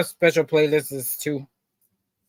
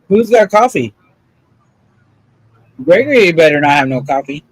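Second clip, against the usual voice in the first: calling out; 7 dB louder than usual. Real, not acted. Calling out for coffee.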